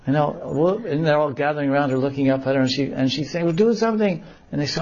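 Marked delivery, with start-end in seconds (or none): 3.4-4.2 put-on high, insistent girl voice; high whiny plea; pleading girl. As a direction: beg them to act. Radha pleads from tree.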